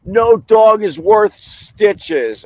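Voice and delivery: high, slow, drawn-out mock voice of a careless dog owner; sneering parody of a careless owner; mocking the guy. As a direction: stretch it out like a snooty fool. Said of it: "and he goes".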